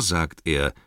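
usual speaking voice

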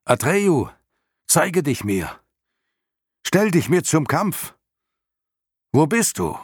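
high, loud hoarse challenge; hoarse shouting. A narrator's reading. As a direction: scream the challenge into the battle. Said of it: "schrie er mit heiserer Stimme".

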